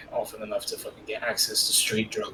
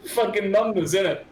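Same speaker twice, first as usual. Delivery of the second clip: excited; 8 semitones higher, 4 dB louder than usual. Real, not acted. Excited at numbers station.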